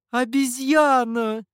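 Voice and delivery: high, loud, drawn-out cry; predatory exclamation. A narrator's reading. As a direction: stretch it out loudly, pouncing on your prey. Demoness pounces on Mihou. (хищно, высоко и протяжно)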